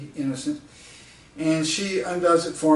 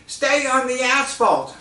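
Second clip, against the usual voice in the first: put-on insistent old lady; 9 semitones higher, 5 dB louder than usual. Lady repeats warning out window.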